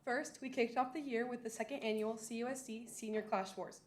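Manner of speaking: ordinary talk, nothing marked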